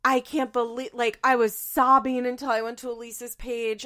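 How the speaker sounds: loud, overwrought mock wail; mock hysterical commenter. Imitating people in the comments, mock hysterical. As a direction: ham up the distress, stretching key words. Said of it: "people are in the comments being like".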